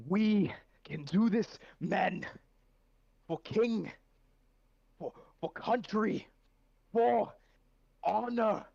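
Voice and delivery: a battle cry where "men" and "honor" land like blows, slow and strong; rallying cry stressing men and honor. Acted. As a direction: shout it out high and loud, hitting "men" and "honor" hardest. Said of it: "I want you to emphasize the word men".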